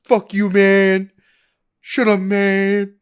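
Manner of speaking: high, loud, drawn-out impression; James Franco. Host impersonates a blacklisted actor swearing, slow loud impression. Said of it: "That's my James Franco impression".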